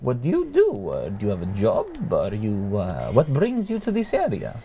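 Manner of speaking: put-on high, curious old man at the campfire; friendly old man questioning a stranger; old gentleman voice.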